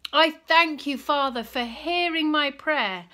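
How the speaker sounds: loud, high prayer of thanks; cried out. Acted. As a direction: call out to heaven. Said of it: "Jesus cried out suddenly".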